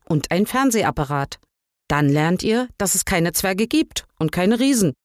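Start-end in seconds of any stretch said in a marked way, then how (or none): none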